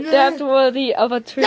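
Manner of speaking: laughing through the words; cracking up while praising. Real, not acted.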